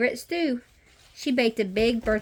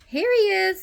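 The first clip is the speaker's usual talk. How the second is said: high, louder call of discovery; 12 semitones higher, 6 dB louder than usual. A narrator's reading. Playmate finding Horace at hide and seek, calling out. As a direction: sing out the find in a child's voice.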